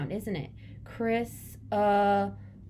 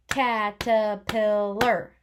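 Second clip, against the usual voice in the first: caterpillar said slowly and louder, each part stretched; sounding it out; 7 dB louder, much slower than usual. Shown as a demonstration. Teacher sounding out caterpillar for kid writing, slow. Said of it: "I'm gonna use my kid writing".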